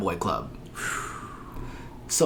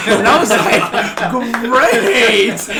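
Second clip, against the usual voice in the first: slow, high laughing Great; 9 semitones higher, 10 dB louder, much slower than usual. Real, not acted. Laughing as the unit praises the assault charge, delighted laugh.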